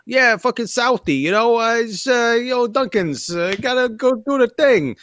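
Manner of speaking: a hammed-up Boston guy; Boston accent. Podcaster mocking a film Bostonian in a Boston accent. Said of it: "a character who's from Boston".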